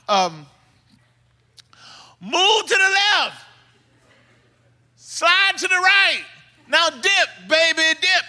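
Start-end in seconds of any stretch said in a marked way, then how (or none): 2.2-8.2 high, hollered step-by-step commands; instructing dad. Retold or imitated. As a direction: call out the moves like a dance caller. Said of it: "he tries to instruct him out".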